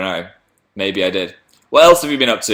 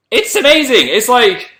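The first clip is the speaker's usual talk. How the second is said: much higher excited praise; thrilled fan gushing; gushing excitement; 8 semitones higher, 3 dB louder than usual. Real, not acted. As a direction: rave about it. Gushing about a TV channel.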